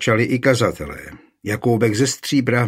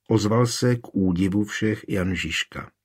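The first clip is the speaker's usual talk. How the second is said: usual speaking voice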